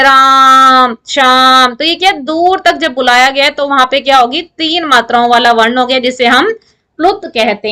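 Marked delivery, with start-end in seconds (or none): 0.0-1.7 louder, drawn-out call of राम then Shyam; teacher demonstrating a long-distance call; calling from afar. Shown as a demonstration. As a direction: call the names to someone far off. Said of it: "जब आप किसी को बुलाते हो".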